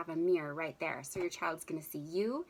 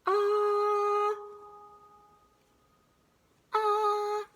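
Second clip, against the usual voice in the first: high sung ah into an echo bucket; echo microphone; 14 semitones higher, 5 dB louder than usual. Shown as a demonstration. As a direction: call out ah into the echoing container. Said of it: "I have this beautiful Echo microphone".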